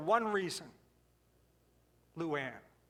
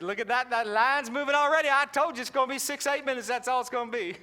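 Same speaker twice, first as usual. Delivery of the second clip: louder, proud Southern hostess; boastful waitress; 3 semitones higher, 4 dB louder than usual. Retold or imitated. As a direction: crow about the moving line. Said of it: "she said".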